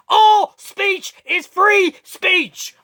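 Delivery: slow, high, shouted chant; shouted slogan. Real, not acted. Preaching free speech to viewers, slow shout. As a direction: hammer each word like a rally cry.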